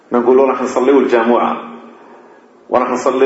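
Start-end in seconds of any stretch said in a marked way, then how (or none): 0.9-1.6 الجمعة as everyday "al-jamu'a"; colloquial mispronunciation. Shown as a demonstration. Teacher quoting "going to pray", الجمعة as colloquial jamu'a. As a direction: put an a after the jim in الجمعة, ja-mu-'a. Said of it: "هذا لا أصل له في اللغة".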